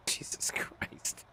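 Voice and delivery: breathy whispered aside, slowed right down. Real, not acted. Streamer whispering an aside to chat, hushed. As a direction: whisper it under your breath.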